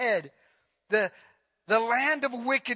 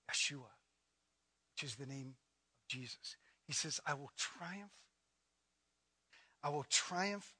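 hushed preaching; 5 semitones lower, 6 dB quieter than usual. Acted. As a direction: draw them in close with a near-murmur. Hushed, intimate scripture reading.

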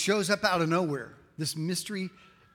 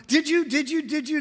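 high, rapid-fire repeated challenge; grilling; 11 semitones higher, 5 dB louder than usual. Acted. God grills Job over and over, insistently demanding. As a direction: hammer the question, pitched up and louder. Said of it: "God really grills Job over and over".

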